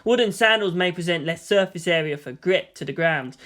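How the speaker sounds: usual speaking voice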